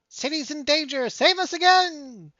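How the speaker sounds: singsong sarcastic wail; mocking mouse. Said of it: "And the mouse goes".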